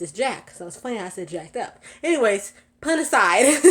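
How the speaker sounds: usual speaking voice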